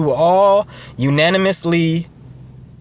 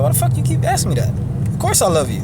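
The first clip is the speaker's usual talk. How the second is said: pissed off; 9 semitones higher, much faster than usual. Retold or imitated. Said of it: "you're gonna get pissed off".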